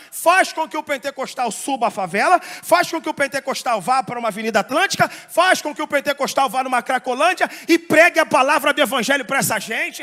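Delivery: loud, rhythmic list building in force; insistent pulpit crescendo; rousing preaching. Real, not acted. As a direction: hammer out each phrase to the crowd.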